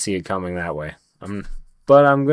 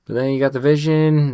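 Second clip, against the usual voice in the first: louder emphatic repeat; 6 semitones higher, 6 dB louder than usual. Real, not acted. Restating with a louder push.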